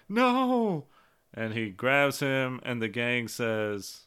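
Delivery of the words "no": high anguished cry of no; shouting. Retold or imitated. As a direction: yell it in despair. Zuko cries out for uncle.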